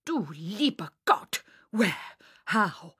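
loud, agitated German professor; alarmed Herr Professor. Acted. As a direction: fire out shocked questions one by one. Herr Professor shocked by news.

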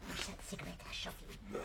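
quick hushed whisper to her husband; whispered order to her husband as guests arrive; whispered aside. Acted. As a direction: hiss it fast under the breath.

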